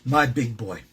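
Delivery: ordinary talk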